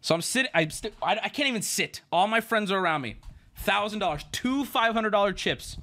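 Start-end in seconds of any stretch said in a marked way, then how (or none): none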